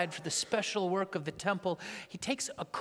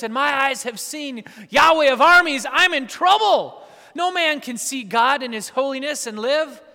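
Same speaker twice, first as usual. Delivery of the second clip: louder, higher cry of doom; terrified prophet; 7 semitones higher, 14 dB louder than usual. Acted. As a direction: wail it like a doomed man. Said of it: "What's Isaiah's response?".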